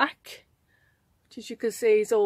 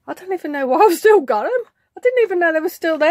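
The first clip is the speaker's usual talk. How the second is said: ordinary talk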